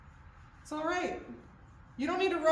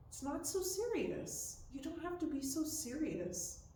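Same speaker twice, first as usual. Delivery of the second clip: tender praise to horse; 3 semitones lower, 7 dB quieter than usual. Real, not acted. Praising her horse softly.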